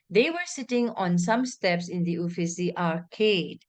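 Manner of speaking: tone goes up, then comes down on arcade; demonstrating a full-stop fall. Shown as a demonstration. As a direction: finish the sentence with a drop in pitch. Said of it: "It drops down".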